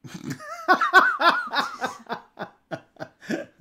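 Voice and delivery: loud high-pitched burst of laughter; a real cackle at the joke. Real, not acted. Laughs at ruined plans.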